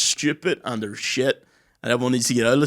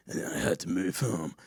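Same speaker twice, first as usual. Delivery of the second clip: low, soft, grim mock confession; gritty storyteller; 7 semitones lower, 7 dB quieter than usual. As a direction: drop low and slow for a hard-luck backstory. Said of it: "this is the gritty part of my story".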